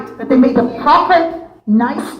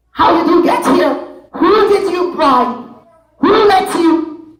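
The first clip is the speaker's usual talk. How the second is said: stern king; 7 semitones higher than usual. Acted. King confronts the intruder.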